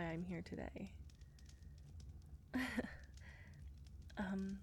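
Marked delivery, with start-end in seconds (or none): 1.5-4.1 soft giggle. Acted. Nervous laugh.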